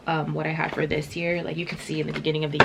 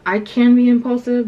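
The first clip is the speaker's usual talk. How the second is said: emphatic; 7 semitones higher, 10 dB louder than usual. Real, not acted. Emphatic self-description.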